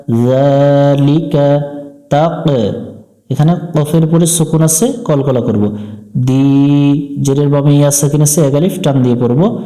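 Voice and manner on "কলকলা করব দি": usual speaking voice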